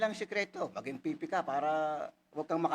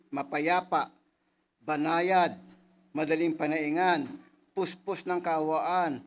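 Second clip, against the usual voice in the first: slow, louder solemn verse reading; scripture reading; 4 dB louder, slower than usual. Acted. Preacher reading James to the congregation, slow and solemn. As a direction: give each quality its own weight, slowly. Reads Santiago verse aloud.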